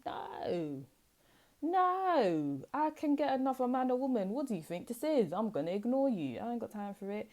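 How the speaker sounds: defiant refusal. Acted. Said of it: "you're just like".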